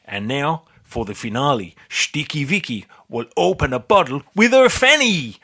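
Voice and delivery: put-on announcer in a Dutch accent. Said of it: "say in a Dutch accent".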